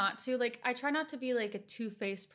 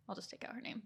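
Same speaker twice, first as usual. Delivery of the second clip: much softer whisper, keeping a secret; whispered aside; 13 dB quieter, faster than usual. Real, not acted. Private aside about friend's name.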